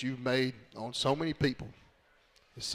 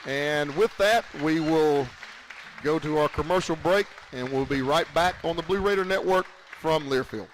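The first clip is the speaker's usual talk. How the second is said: radio sign-off; 4 semitones higher, 7 dB louder than usual. Acted. Host sending listeners to commercial, broadcast announcer read. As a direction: send it to the break like a radio host.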